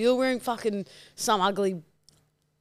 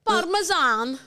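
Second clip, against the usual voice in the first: put-on higher, rising Italian-accented word; 6 semitones higher, slower than usual. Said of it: "anything in an Italian accent".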